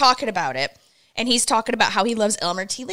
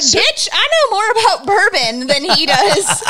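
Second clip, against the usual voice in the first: higher, sassy comeback she wished she'd said; sassy retort; 8 semitones higher than usual. Retold or imitated. Wife imagines telling off the drunk guy, sassy. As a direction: snap back at the know-it-all, mock defiant. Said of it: "I wanted to be like".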